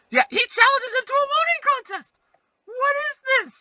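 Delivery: high, exasperated squeal of disbelief; outraged disbelief. Real, not acted. Reacting to the moaning contest, high disbelieving squeal.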